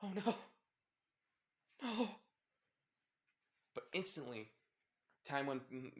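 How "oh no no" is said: high-pitched acted dread; high, alarmed exclamation; alarmed cry. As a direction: cry out as if it's too late. Acted alarm as spear flies.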